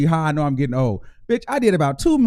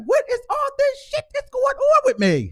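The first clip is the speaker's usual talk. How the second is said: high, squeaky mock bewilderment; mock bewildered. Host complaining to callers about aging, squeaky bewildered whine. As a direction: whine it out like your body betrayed you. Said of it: "It's like".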